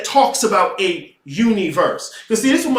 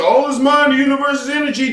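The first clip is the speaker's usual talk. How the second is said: mocking quote; 7 semitones higher, faster than usual. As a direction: toss off the slogans mockingly. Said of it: "motherfuckers are so quick to".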